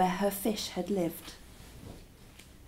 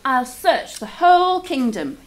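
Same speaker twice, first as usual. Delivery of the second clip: louder, higher, firm kingly vow; 4 semitones higher, 7 dB louder than usual. A narrator's reading. King vows to search the kingdom, firm and loud. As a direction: swear it like a king. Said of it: "he vowed".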